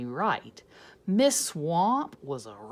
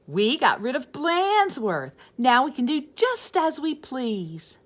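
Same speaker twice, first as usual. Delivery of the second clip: put-on higher, louder gleeful kids; 6 semitones higher, 7 dB louder than usual. Class gloating about freedom, higher and louder. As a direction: gloat like kids set free.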